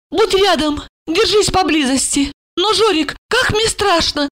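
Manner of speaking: high-pitched anxious pleading; tipsy woman working herself up; frightened drunk woman. A narrator's reading. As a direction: beg for company in a high, urgent voice. Drunk woman begs him stay close.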